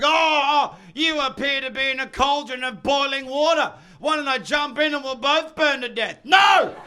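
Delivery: high, loud mock offer ending in a yell; mock dopey then shouting. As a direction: ham up the idiot then bark no.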